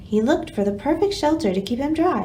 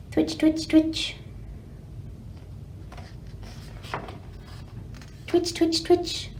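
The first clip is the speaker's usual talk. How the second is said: twitching squirrel nose; 4 semitones higher, 8 dB quieter, slower than usual. Acted. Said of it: "said a nosy squirrel's nose".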